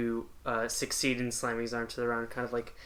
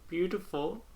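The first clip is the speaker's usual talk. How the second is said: BEAUTIFUL pitched up, rising at the end; higher, insistent question on BEAUTIFUL; frowning question; 7 semitones higher than usual. Acted. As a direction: ask BEAUTIFUL with a doubtful lift. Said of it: "she frowns and".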